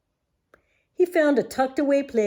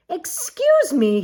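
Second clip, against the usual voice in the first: polite otter; 9 semitones higher, 5 dB louder, slower than usual. Acted. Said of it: "he said".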